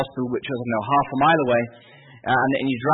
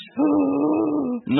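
long, very high imitated gasp; mock gasp for breath; 14 semitones higher than usual. Patient refusing the nurse again, mock gasping. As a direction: strain for air before saying no. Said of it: "lying there gasping for breath".

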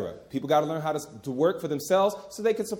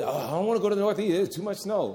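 mock whiny complainer; 2 semitones higher than usual. Mocking people afraid of snow.

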